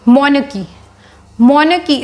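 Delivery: "monarchy" said as MON-uh-kee, stress first; teacher introduces the word; pronunciation demo. Shown as a demonstration. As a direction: model "monarchy" with a falling close. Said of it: "एक और word याद आ रहा है".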